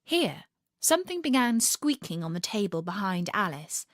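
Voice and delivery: ordinary delivery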